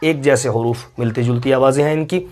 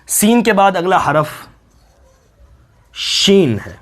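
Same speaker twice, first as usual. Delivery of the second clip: ordinary talk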